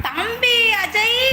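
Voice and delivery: calling out. Real, not acted. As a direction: hail him from afar, stretched. Calls out to a viewer. (உயர்ந்த குரலில் நீட்டி அழைத்தல்)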